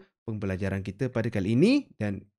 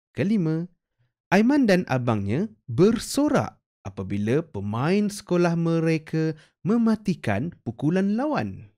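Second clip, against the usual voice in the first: lively higher read-aloud, each sentence shaped with clear rising and falling tune; model reading with intonation; 5 semitones higher than usual. Shown as a demonstration. Teacher models story sentences for pupils to copy, lively and higher. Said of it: "itu adalah contoh ayat-ayat dan intonasi yang sesuai".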